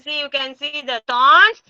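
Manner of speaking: ordinary talk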